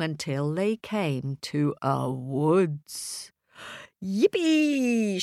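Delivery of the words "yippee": a high happy yippee; excited shout. Acted. Middle Pig finds the woods and cheers high. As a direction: cheer at the sight of sticks. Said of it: "shouted Middle Pig".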